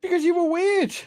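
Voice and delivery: very high, mock-defensive squeak; high-pitched bickering comeback; defensive retort. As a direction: justify yourself in a high pitch. Retort in a teasing quarrel.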